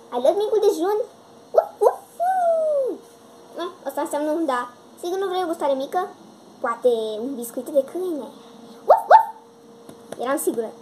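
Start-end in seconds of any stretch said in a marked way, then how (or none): 1.6-3.0 puppy bark. Acted. The new puppy answers its owner with high yappy barks. 8.9-9.2 high loud yappy woof; puppy bark. Acted. The new puppy answers its owner with high yappy barks.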